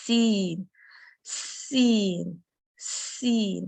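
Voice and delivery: سین with a sharp hissing س at the start; whistled seen. Shown as a demonstration. Teacher showing students the whistle before سین: crisp hissed س. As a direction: start سین with a clear whistle of air, then the vowel. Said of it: "پہلے آپ وسل لے کے آئیں گی".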